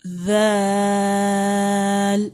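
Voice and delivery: letter names held long in a chant; drawn-out recitation. Shown as a demonstration. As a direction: chant each letter slowly. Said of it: "next letter دیکھتے ہیں".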